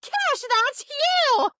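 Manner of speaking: high, drawn-out mock outburst; high-pitched retold exclamation; Nana's exclamation. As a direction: imitate grandma blurting it out, stretched and high. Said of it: "Nana just goes".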